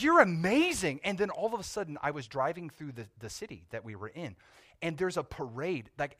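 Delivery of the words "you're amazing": higher and louder, bursting with praise; mock-amazed policewoman raving at a driver; gushing officer. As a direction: play the officer who can't believe how great he is. Officer gushing over him.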